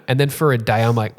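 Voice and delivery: ordinary delivery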